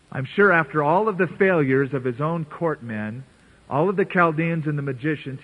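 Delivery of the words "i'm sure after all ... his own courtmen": ordinary talk, nothing marked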